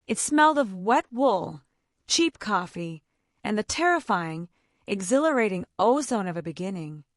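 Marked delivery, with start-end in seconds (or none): none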